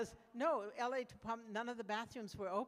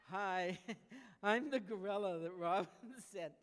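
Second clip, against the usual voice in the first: laughing gorilla; 5 dB louder than usual. Pretend. Gorilla arriving at the door.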